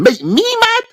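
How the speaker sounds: mock disbelief that anyone could anger him; incredulous scoff. Real, not acted. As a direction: squeak the question in disbelief. Scoffing at being called mad.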